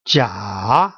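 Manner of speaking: Jia dipping low, then rising; low scooped Jia with an upturned end; third tone. Shown as a demonstration. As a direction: take Jia down deep and bring it back up. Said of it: "Going down and then going up".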